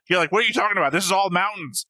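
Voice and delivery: ordinary talk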